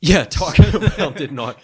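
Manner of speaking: loud, high, laughing denial; laughing disclaimer. Real, not acted. Cohost backing off a joke about Taco Bell, laughing denial. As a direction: laugh through a hasty denial.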